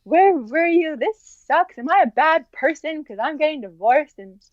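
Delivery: high, pleading retold cry of despair; tearful cry to God. Alone on her bed, crying out to God, high tearful plea. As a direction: replay the anguished plea, voice climbing high. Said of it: "lying there crying".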